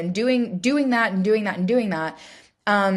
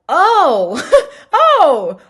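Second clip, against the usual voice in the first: loud, high "oh" with a laugh; sudden realization; 8 semitones higher, 9 dB louder than usual. Retold or imitated. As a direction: act out catching yourself, amused and loud. Acts out catching herself clenching.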